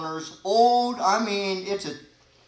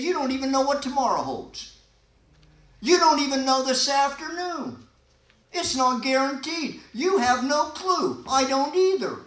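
louder, higher preaching push; fiery preaching; 6 semitones higher, 5 dB louder than usual. Acted. Preaching at full force.

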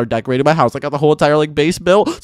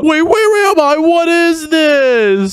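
high-pitched, drawn-out bewilderment; alarmed confusion; 8 semitones higher, much slower than usual. Acted. Player lost in a strange place, alarmed and confused. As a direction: stretch it out high, thoroughly bewildered. Suddenly finds himself somewhere strange.

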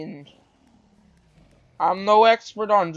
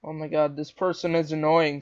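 usual speaking voice

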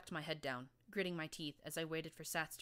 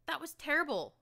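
high, loud protest from the mare; Glory countering; 11 semitones higher, 9 dB louder than usual. Acted. Glory snaps back at the narrator, loud and high. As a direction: push back hard, voice shooting up. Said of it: "she countered".

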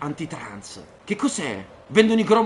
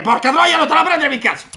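sudden loud outburst, higher; random yelling; 8 semitones higher, 7 dB louder than usual. Real, not acted. Streamer yelling at random at his chat. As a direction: bark it out of nowhere. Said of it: "io urlo a caso".

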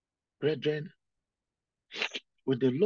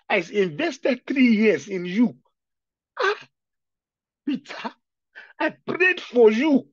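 higher, louder, breaking into a wail; wounded love pleading through tears; tearful plea; 7 semitones higher, 9 dB louder, slower than usual. Acted. Jesus pleading tearfully with Peter, voiced by weeping preacher.

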